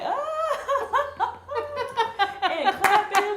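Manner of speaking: high, loud squealing laughter; child laughing. Retold or imitated. Four-year-old squealing with laughter at the forum. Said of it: "she was laughing".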